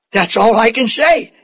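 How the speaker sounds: ordinary delivery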